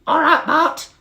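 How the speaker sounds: very high, loud squeaky beetle voice; high squeaky Bart. Acted. Dim little brother greeting Barry, high squeaky voice. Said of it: "they said".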